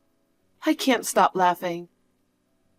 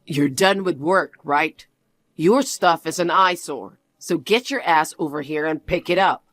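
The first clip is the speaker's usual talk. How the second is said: lower, cold lecturing voice; condescending husband; 5 semitones lower than usual. Acted. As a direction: talk down to your wife, low and smug. Husband voice again.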